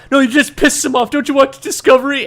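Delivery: hurried mock scold. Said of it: "one of them is like".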